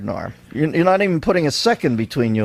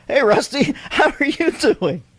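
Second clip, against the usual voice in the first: mock man voice; 9 semitones higher than usual. Shown as a demonstration. [name] greets the puppet in a mock manly voice. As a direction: put on a man's voice, slowed, with a laugh breaking in. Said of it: "Let me give you my man voice".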